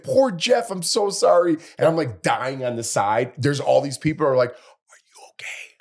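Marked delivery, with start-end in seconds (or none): 0.0-1.6 high-pitched imitation of a sympathetic co-host; Denise. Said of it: "Denise, my co-host ... she's like". 4.5-5.7 slow, voiceless imitation of mouthing; barely audible whisper, as if only mouthing it; mouthed whisper. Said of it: "like, mouthing".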